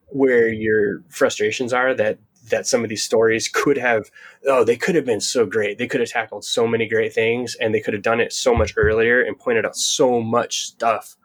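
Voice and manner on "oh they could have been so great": ordinary delivery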